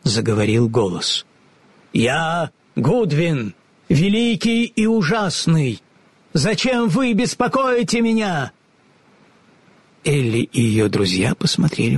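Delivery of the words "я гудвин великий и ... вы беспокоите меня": high, slow, grand pronouncement; booming wizard voice. A narrator's reading. Goodwin announces himself to the travellers, grand and booming. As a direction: play the great and terrible wizard.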